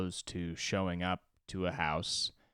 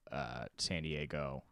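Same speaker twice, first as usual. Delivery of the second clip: usual speaking voice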